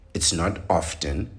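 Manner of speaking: "often" as OFF-ten, with the T sounded; mispronounced "often" with an audible T; T pronounced in often. Shown as a demonstration. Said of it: "You don't pronounce the T".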